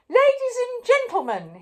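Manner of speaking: high, loud royal announcement; pompous king hailing his subjects; king's address. A narrator's reading. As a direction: proclaim it grandly to the crowd. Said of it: "he said to the crowd".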